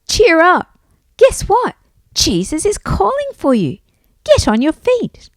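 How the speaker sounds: put-on high, loud, urging girl; rally him with good news as a girl; girl in crowd. A narrator's reading. Girl tells Bart he is called, high-voiced urging. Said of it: "said a girl in the crowd".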